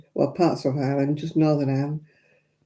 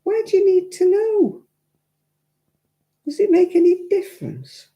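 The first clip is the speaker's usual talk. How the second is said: high-pitched exasperated questioning; 14 semitones higher than usual. Acted. Reacting to the tick boxes, high exasperated protest. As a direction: pitch each question way up in disbelief. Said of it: "and I'm like".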